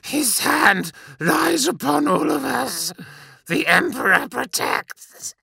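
high, slow wheezing devotion; death rattle. A narrator's reading. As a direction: gasp it out, dying.

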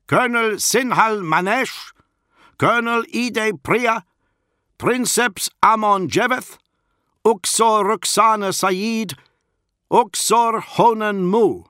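put-on high, loud herald in a thick Iskian accent; Iskian accent call-out. A narrator's reading. Lucifer guard announces those summoned, calling out in a thick Iskian accent. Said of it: "called out in his thick Iskian accent".

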